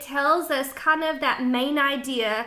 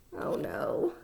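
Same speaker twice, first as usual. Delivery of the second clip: whisper; 5 semitones higher, 10 dB quieter than usual. Real, not acted. Teacher reacts to horse.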